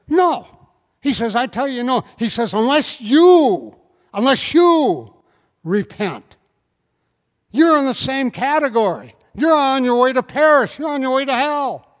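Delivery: loud, ringing pulpit preaching; loud fiery preacher; preaching at full force. Acted. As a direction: drive it home to the congregation. Jesus's warning, preached loud.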